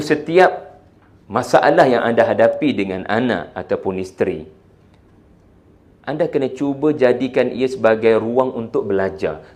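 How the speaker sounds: ordinary talk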